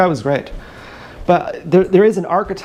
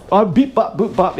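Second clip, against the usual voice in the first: lightly raised silly robot chirp; mock beep-boop; 5 semitones higher than usual. Comedian recalling his man impression, mocking beeps. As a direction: toss off a mocking burst of beeps.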